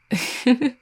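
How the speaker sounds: short high chuckle; amused chuckle. Acted. Vampire amused at partner's doubts, high chuckle.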